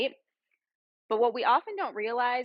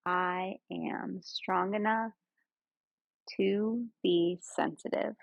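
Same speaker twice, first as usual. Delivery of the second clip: guided meditation; 3 semitones lower than usual. Real, not acted. Yoga guide leading friends through breath and body scan, guided meditation. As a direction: ease them into stillness, lower and unhurried.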